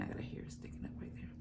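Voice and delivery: fast, breathy whispered aside; whispering. Real, not acted. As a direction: whisper it quickly to yourself. Quick aside to camera.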